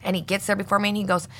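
ordinary talk, nothing marked

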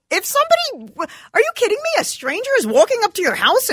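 high, disbelieving cry; can't believe what she heard; incredulous outburst. Real, not acted. Reacting to the letter with disbelief. Outraged at the stranger question.

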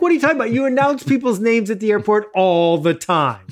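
high, indignant disbelief at the gate agent; incredulous retort. Retold or imitated. Retelling arguing with the gate agent, incredulous and high. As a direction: scoff at her excuse in disbelief. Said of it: "I'm like".

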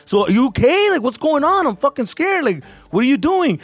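put-on agitated boyfriend. Worried boyfriend questioning her through the door, agitated. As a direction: act out his alarmed demand. Boyfriend demands answers in retold scene.

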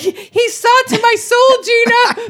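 high, loud and laughing through words; half crying with laughter. Real, not acted. Laughing at being seen through.

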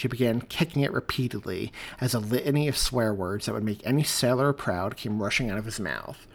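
ordinary delivery

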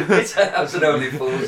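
slow words through laughter; laughing. Real, not acted. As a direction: still laughing as you speak. Laughing at Peckham joke.

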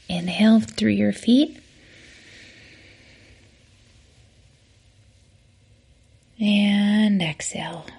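soft, whispered breathing cues with long pauses. Acted. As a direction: guide each inhale and exhale gently. Leads a breathing exercise.

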